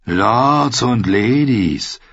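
drawn-out, very slow scornful announcement; disgusted. Acted. Gendry mocks the nobles behind them, disgusted. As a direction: stretch every word with contempt. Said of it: "verkündete er angewidert".

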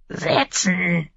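high, thin drawn-out bird squawk; screeching raven. A narrator's reading. Raven echoing its master's order, high screech. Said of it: "kreischte der Rabe".

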